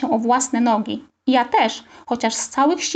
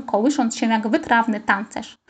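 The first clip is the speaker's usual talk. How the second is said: usual speaking voice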